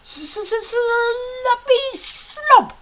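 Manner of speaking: high, loud stammering jeer; calling out taunt. A narrator's reading. Small dragon taunting Fireworm from afar. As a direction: fling the insult across the sky. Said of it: "Toothless called out".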